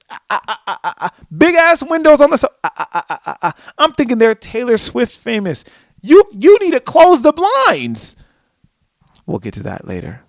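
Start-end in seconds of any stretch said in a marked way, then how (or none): 0.1-8.2 spluttered cries rising to a high shout; mock alarm. Warning famous lovers to close the blinds, loudly alarmed.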